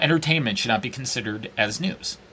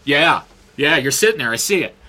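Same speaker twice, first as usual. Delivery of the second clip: louder, higher taunting challenge; mock tough-guy threat; 4 semitones higher, 7 dB louder than usual. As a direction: square up and taunt him. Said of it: "be this far from somebody and go".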